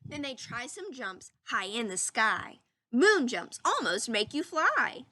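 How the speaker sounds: put-on lively storybook reading for children; read-aloud voice. Teacher reading a story to her class, lively read-aloud. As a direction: read it like a picture book to the class.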